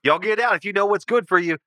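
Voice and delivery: high, fast warning from a paranoid homeowner; hostile recluse. Acted. Hidden homeowner warns intruders off his fence, hostile. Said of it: "You hear a voice say".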